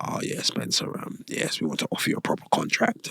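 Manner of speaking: soft whispered imitation of the boss; whispered boss offer. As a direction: hush it like a secret offer. Boss offers contract, voiced by teller.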